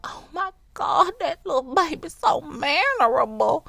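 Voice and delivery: high, loud gushing praise; gushing admirer. Retold or imitated. Stranger praising my polite baby, high gushing voice. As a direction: coo over the baby like a delighted stranger. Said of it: "they're gonna be like".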